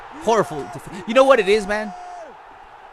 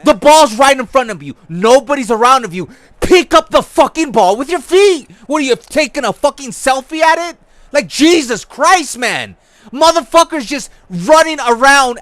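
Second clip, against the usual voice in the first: angry rant; 5 semitones higher, 8 dB louder than usual. Real, not acted. Yelling at his player.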